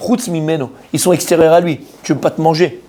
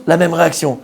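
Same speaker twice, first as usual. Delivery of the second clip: normal talking voice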